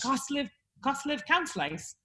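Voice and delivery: soft, halting copy of Stoke dialect; Stoke attempt. Shown as a demonstration. Host practises the phrase, hesitant Stoke accent. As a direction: try the Stoke phrase under your breath. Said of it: "you do a Stoke thing".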